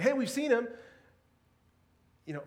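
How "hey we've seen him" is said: high, loud burst of good news; mock-eager shout of news; excited news. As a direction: blurt it out like thrilling gossip. Disciples excitedly telling Thomas.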